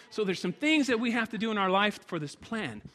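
high, loud, driving preacher's build toward the altar call; preaching at full force. Acted. Pastor urging the church to seek God, preaching at full force.

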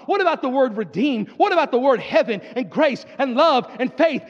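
high, rhythmic pulpit build; impassioned preacher rallying the congregation; full-force preaching. Acted.

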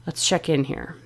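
low, soft, calming voice; relaxed exhale. Acted. Biggest fan tells her to relax, low and calming. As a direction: let it out like a long exhale, soothing. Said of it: "feels like this giant exhale".

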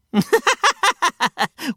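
high, staccato burst of laughter; incredulous high-pitched laughing fit. Acted. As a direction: laugh in a rapid high cackle. Laughs at news of winter.

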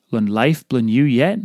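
ordinary talk, nothing marked

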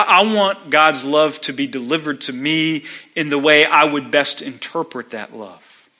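ordinary talk

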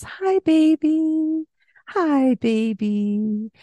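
high, slow, drawn-out greeting; baby talk. Shown as a demonstration. Mother greeting her baby in slow high baby talk. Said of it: "that higher pitch in our voice".

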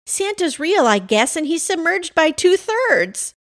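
high-pitched, incredulous report; disbelieving. A narrator's reading. Pam tells Rudy of Santa's plight, high disbelieving voice. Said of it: "not believing her words".

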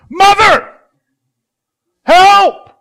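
screamed high and loud, full desperation; desperate scream. Retold or imitated. Child desperately screaming to mother for help. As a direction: shriek for help like your life depends on it. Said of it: "this is a cry that says".

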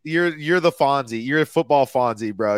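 normal talking voice

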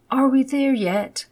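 put-on high and loud child's whine; impatient little boy. Child asking a third time.

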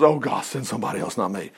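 soft, low, shrinking back; understated begging off; reluctant plea. Acted. Moses didn't want to go, pleading quietly with God.

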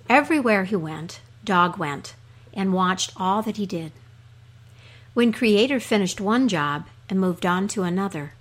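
usual speaking voice

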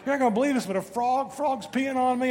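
high, excited disbelief, quoted to the wife. Retold or imitated. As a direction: squeal it out in mock alarm. Telling wife about frog.

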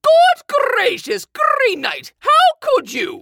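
loud, slow shocked exclamation; appalled at the betrayal; shocked outrage. Acted. Outraged at his brother.

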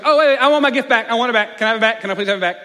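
high, loud frantic begging; loud high childish pleading for the gift back; pleading kid. Retold or imitated. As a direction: beg like a kid who just saw the cash.

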